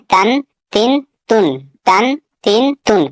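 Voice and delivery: تن sounded again and again with a clear n; teacher leading a تن drill; drill to repeat. Shown as a demonstration. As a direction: chant تن for students to follow. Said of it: "اگر آگے پڑھنا چاہیں تو آپ پڑھ سکتے ہیں".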